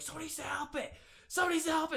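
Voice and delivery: high, urgent cry for help; panicked cry. Retold or imitated. Podcaster voicing beachgoers crying for help for a whale. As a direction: plead for help as a frantic beachgoer. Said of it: "And they're like".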